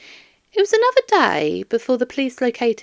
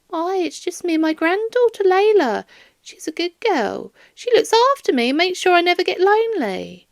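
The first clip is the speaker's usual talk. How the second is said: higher, fond grandmother voice; doting old lady; 10 semitones higher, 3 dB louder, faster than usual. Acted. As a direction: gush about her granddaughter. Doris proudly describes granddaughter.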